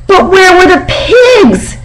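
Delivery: high, shouted demand from a small pig; biggest voice. Acted. Piglet demanding of the barn animals, high shout. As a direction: yell it as big as a tiny piglet can. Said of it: "she demanded in her biggest voice".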